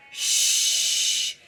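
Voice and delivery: loud breathy shh; American shh. Retold or imitated. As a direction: exhale a strong shh. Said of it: "in my American accent".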